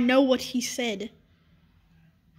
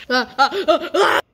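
clown laugh; 5 semitones higher, 5 dB louder than usual. Acted.

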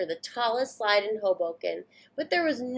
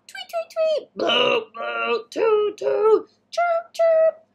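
chirpy birds and hooting boats, played for kids; bird and boat noises; 12 semitones higher, 6 dB louder, much slower than usual. Reader voicing the noisy harbour for children, playful chirps. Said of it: "the birds were making bird noises".

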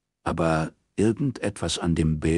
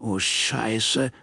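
ordinary delivery, nothing marked

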